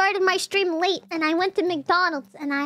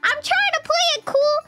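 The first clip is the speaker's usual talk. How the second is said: whiny protest; 10 semitones higher than usual. Real, not acted. Streamer protesting chat teasing.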